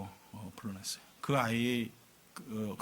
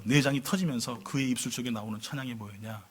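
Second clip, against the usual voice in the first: normal talking voice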